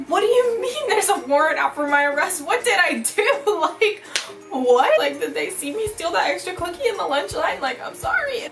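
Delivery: high, incredulous protest; mock panic. Teen protesting to her aunt about a warrant, comically panicked. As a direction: act out bewildered outrage, pitch climbing.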